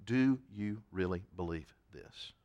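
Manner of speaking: low, hushed, deliberate question; quiet searching question. Retold or imitated. Preacher voices God looking you right in the eye, quiet and searching.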